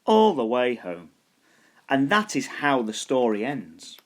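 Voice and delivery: ordinary delivery, nothing marked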